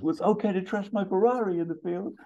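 high-pitched put-on indignation; acted squawky comeback; Axel Foley. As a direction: retort in a high, incredulous character voice. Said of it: "and Axel goes".

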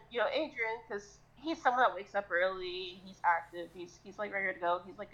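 normal talking voice

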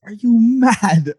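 mocking UK rapper drawl; mock UK taunt. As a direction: draw it out like a teasing London rapper. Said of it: "That's my favorite".

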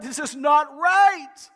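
slow, loud anguished cry; Mary grieving at the tomb, loud slow cry. Retold or imitated. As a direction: wail it out in grief. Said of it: "she's still crying out".

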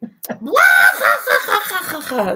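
high, loud cackling laugh; loud burst of laughter. Real, not acted. Laughing at inappropriate conversation.

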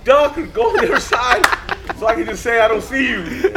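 high, loud pleading holler; retold yell. Telling riders to hide so he won't see them, retold yell. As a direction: shout instructions to someone far off. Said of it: "I yelled it, dude".

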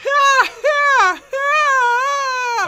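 high squealed ha-ha-ha; piercing, drawn-out mock laugh; mimicked distant laughter. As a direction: imitate someone cackling from another room. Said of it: "other side of the house going".

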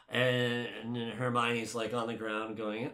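ordinary talk, nothing marked

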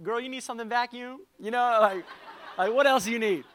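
husband thrilled by his wife's kiss, laughing and loud; eager laughing offer. Retold or imitated. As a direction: overeager to please, laughing.

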